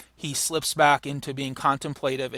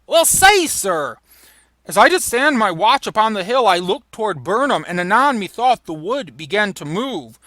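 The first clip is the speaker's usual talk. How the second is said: raised, urgent report; hesitant messenger reading; 5 semitones higher, 5 dB louder than usual. Acted.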